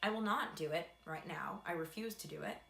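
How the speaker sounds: ordinary delivery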